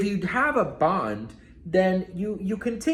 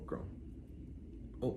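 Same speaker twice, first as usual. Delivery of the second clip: usual speaking voice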